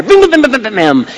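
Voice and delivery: quick-fire patter, starting high and dropping; rapid fire. Shown as a demonstration. Pastor showing how quickly he thinks, rapid fire. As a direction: rattle it off like rapid gunfire. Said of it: "think very rapid fire".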